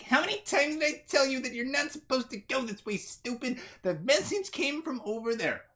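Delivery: high-pitched, exasperated scolding; scolding small woman. Acted. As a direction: tell off a companion in a high voice. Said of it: "And she's like".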